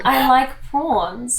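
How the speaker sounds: slow broad-Aussie "I like prawns"; Australian accent. Shown as a demonstration. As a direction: lean into the Aussie vowels. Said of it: "accent challenge".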